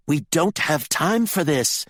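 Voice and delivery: high, slow, emphatic impatience; urgent rebuke. A narrator's reading. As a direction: cut the quarrel short, firmly. Candy cuts short the brothers' quarrel.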